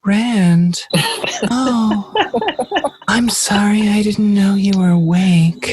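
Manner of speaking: high breathy seductive purr; sexy woman voice. Pretend. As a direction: breathe it out like a temptress. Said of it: "the sexy woman voice".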